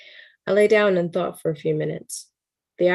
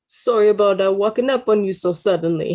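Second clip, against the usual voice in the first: apologetic Brooklyn hiker, a bit louder; Brooklyn accent; 2 semitones higher, 5 dB louder than usual. Acted. Hiker apologizes for startling the narrator in Brooklyn accent. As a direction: put on a playful Brooklyn accent. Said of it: "How's, how's my Brooklyn accent, guys?".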